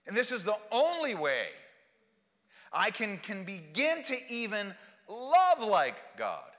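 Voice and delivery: fervent proclamation; impassioned preaching. Real, not acted. Preaches at full force.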